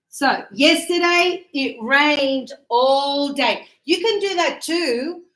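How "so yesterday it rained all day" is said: yesterday it rained all day said slowly; teacher modelling a sentence slowly for students; slow model sentence. Shown as a demonstration. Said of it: "So when you say".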